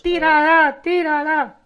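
loud high call to throw it; excited mock yell; shouted urging. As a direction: yell it out like a game show chant.

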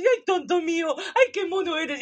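high, loud cooing at a dog, laughing; talking to a dog; baby talk to pet. Retold or imitated. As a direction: gush over a silly dog with a giggle.

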